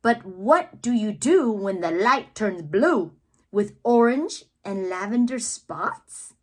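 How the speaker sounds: usual speaking voice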